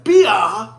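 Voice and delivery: "beer" echoed as a questioning "bee-ah"; mock Australian "beer". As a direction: repeat "beer" Aussie style, as a question. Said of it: "I was like".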